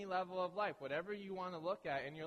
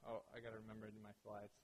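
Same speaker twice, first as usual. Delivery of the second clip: muttered aside; 6 semitones lower, 10 dB quieter, faster than usual. Real, not acted. Aside to himself.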